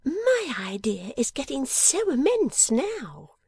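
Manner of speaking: high, drawn-out little-dressmaker voice; overwhelmed girlish character, slow and high; crying out, overwhelmed. Acted. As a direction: cry it high, stretching the words. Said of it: "cried Miss Wren".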